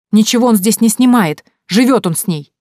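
high, loud accusation dropping at the end; indignant. A narrator's reading. Woman telling relatives they live together, indignant. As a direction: expose them with indignation. Liza exposes them to relatives.

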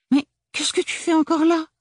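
very high, loud, gushing big sister. Acted. Agathe greeting her little sister, high and gushing. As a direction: sweep in all sugary.

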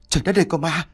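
high-pitched cry of fright; startled scream. A narrator's reading. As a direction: jolt awake crying out at a ghost. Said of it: "giật mình thêm cái nữa rồi hét lên".

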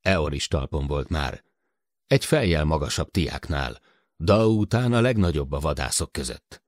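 plain reading voice

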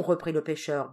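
ordinary delivery, nothing marked

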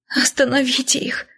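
выше и тише, с всхлипом; всхлипни мольбу сквозь слёзы. A narrator's reading. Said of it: "всхлипнула я". (всхлипывающая мольба, выше и тише)

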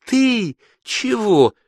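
high, slow incredulous question; astonished. A narrator's reading. Joy can't believe her fiancé is afraid, astonished. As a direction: stretch it out in disbelief. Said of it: "воскликнула Джой".

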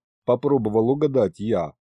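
plain reading voice

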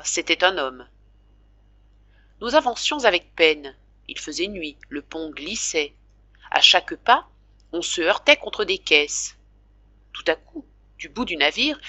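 usual speaking voice

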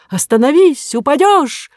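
high, loud alarmed cry; shouting. Acted. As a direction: yell a warning. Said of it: "кинулся сзади к Коре с криком".

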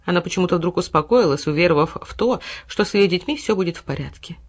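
ordinary delivery

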